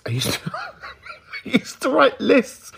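high-pitched, breaking into giggles; laughing. Real, not acted. Confessing a childhood habit to listeners, laughing. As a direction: laugh through the words.

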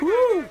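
a loud, high whoop; high excited woo. Real, not acted. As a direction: cheer at the finale.

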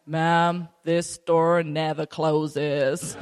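slow, drawn-out security guard voice. Retold or imitated. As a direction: stretch it out like an unhurried store guard. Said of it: "I said to the security guy".

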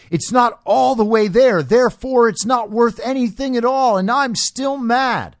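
mock the complainers in a whiny voice. Mocking complainers about good news.

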